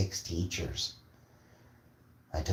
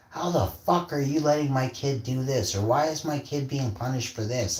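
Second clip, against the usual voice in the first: higher-pitched mock outrage; scolding parents; 8 semitones higher than usual. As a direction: imitate parents telling off a teacher. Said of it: "they scold teachers".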